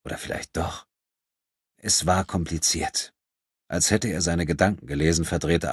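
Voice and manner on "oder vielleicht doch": plain reading voice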